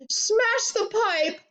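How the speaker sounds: high, drawn-out yell; frantic high-pitched command. A narrator's reading. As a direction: screech the order slowly. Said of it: "yelled Missus Globe".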